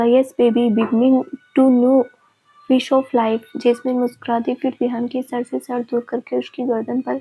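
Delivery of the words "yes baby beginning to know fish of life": usual speaking voice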